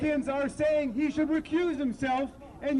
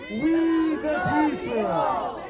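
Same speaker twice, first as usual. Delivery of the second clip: loud, drawn-out proclamation; rallying shout; 6 dB louder, much slower than usual. Real, not acted. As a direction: shout it slowly for a crowd to join. Rallying crowd with Constitution's opening words.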